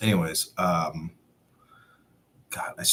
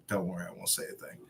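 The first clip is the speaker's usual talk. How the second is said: much softer, quick and meek; meek reply; 10 dB quieter, faster than usual. Retold or imitated. Answering the threatening captain, a quick meek whisper-soft reply. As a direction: back down quietly.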